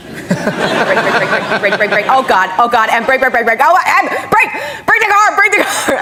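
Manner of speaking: rapid muttering building to high panicked cries; Tom Cruise. Retold or imitated. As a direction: imitate a passenger's mounting panic. Imitates passenger's building panic.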